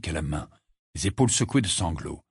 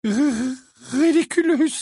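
very high, loud, sobbing incantation; sobbed spell; 6 dB louder, slower than usual. A narrator's reading. Missus Wesley points her trembling wand, sobbing. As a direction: stammer the spell out through sobs. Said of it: "sanglota-t-elle".

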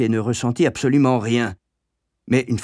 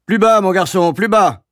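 louder, higher, dry command; curt order; 8 semitones higher, 7 dB louder than usual. A narrator's reading. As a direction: order it sharply, no room for argument. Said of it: "ordonna sèchement monsieur Coombs".

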